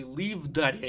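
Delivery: plain reading voice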